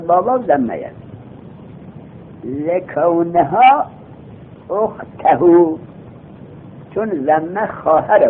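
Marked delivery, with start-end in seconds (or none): 2.4-5.8 آهسته و کشیده; very slow, drawn-out reading. Shown as a demonstration. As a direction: stretch out لکونها and اختهو syllable by syllable. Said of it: "drawn-out recitation".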